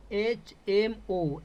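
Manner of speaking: slow, separated एच, एम, ओ; each letter of एच एम ओ given its own beat. Shown as a demonstration. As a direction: spell out एच एम ओ slowly for a class. Teacher sounds out an abbreviation.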